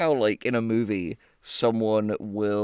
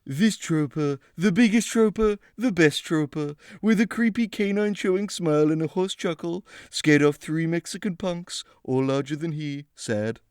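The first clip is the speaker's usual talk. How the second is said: higher, puffed-up boastful read; bragging hero voice; 5 semitones higher than usual. Shown as a demonstration. Host rereads the troper's boast in a proud voice. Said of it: "I read that in the wrong voice".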